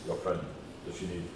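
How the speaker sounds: halting foreign helper; broken-English stranger voice. Acted. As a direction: stumble through the words kindly. NPC character voice, broken speech.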